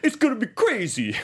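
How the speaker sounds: high, swaggering greaser impression; Danny Zuko. Host plays the greaser recruiting kids into the Foot, high put-on voice. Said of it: "recruited in by Danny Zuko".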